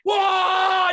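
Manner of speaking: high, shouted whoa; excited whoa. Retold or imitated. Guest recalling the crowd's roar, high excited shout. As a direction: ride the crowd's energy.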